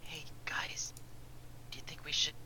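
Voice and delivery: breathy in-character whisper. Acted. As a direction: whisper it to the party. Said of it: "Please don't whisper into the mic".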